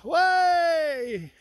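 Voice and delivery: loud high exultant yes; joyful cry. Real, not acted. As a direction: burst out in sheer delight. Joy at understanding death.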